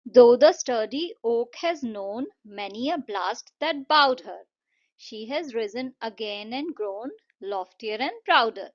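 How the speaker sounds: slow, measured verse with pauses between lines; poem recitation. Shown as a demonstration. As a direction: recite it slowly for children, phrase by phrase. Reads poem stanza before explaining. (धीमी लय में कविता पाठ)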